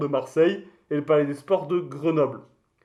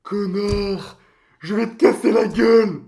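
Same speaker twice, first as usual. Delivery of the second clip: angry; 4 semitones higher, 4 dB louder than usual. Acted. Insulted over his name, threatens.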